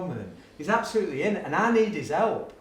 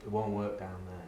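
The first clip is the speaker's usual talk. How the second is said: put-on deadpan know-it-all kid; 9 semitones lower, 10 dB quieter than usual. Boy Jack correcting the teacher, low and soft. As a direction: lower and softer, flat matter-of-fact kid.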